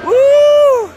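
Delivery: loud high victory woo; triumphant whoop. Real, not acted. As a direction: scream of joy at the finish. Triumph after finishing.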